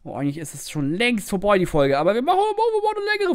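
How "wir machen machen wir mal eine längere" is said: high playful. Real, not acted.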